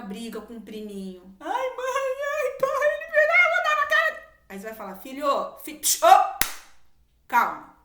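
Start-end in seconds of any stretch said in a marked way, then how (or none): 1.4-4.3 put-on high, loud crying child voice; whiny child. Child complaining to mom and dad, high whining cry. 5.0-7.8 put-on calling child to calm. Parent calming agitated child.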